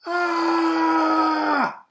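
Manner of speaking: long, high, loud terrified scream; dragon's scream. Acted. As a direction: scream in terror like a frightened dragon. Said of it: "screamed the dragon".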